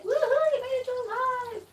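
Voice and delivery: high, bright woohoo; cheering welcome. Real, not acted. Host cheering a viewer who joined the live stream. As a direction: cheer a friend who made it.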